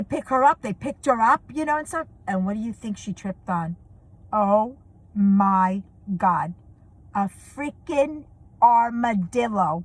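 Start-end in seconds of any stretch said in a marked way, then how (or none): 4.3-9.8 slow, drawn-out disbelief; slow storyteller's build-up to a reveal; dramatic reveal. Real, not acted. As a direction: stretch each word out for suspense.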